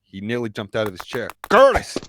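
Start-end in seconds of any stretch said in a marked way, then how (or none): 1.5-2.0 startled cry, high and loud; startled gasp. Acted. Uncle John nearly jumps from his chair, startled gasp. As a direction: call out the name in sudden shock. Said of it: "he gasped".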